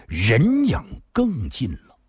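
usual speaking voice

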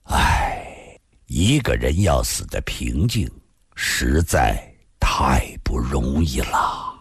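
low, slow, airy reflection after a sigh; sigh, then say it low and heavy; sighing lament. A narrator's reading. Narrator sighs over a death.

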